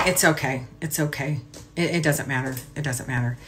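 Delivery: muttered self-reassurance. Retold or imitated. Telling herself for months it doesn't matter, quietly. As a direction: soothe yourself under your breath, brushing it off. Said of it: "just going".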